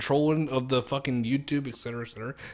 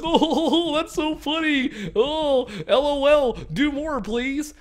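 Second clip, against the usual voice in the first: put-on much higher, louder kid voice laughing through it; mock giggling kid; 9 semitones higher, 5 dB louder, slower than usual. Mocking twelve-year-olds laughing at fake prank videos, giggly high voice. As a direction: play a giggly twelve-year-old begging for more. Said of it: "twelve-year-olds are like".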